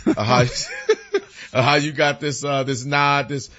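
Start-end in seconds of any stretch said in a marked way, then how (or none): 0.5-1.5 a sudden high laugh bursts out. Real, not acted.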